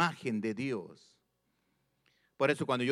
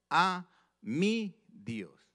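"a mi Dios" very slow, "mi" lifted high; stress on mi; 5 semitones higher, much slower than usual. Shown as a demonstration. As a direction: draw out "mi" in "a mi Dios". (énfasis lento en "mi")

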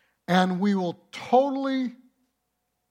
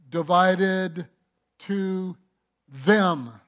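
divided and them pushed louder; stress on them; 4 dB louder, much slower than usual. Shown as a demonstration. Preacher reading the verse, stressing them. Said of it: "Notice the word ... the word "them".

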